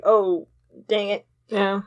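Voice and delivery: ordinary delivery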